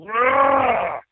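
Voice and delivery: high exasperated roar, retold; frustrated growling shout. Singer on hearing he missed out, exasperated yell. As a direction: let out a mock roar of frustration.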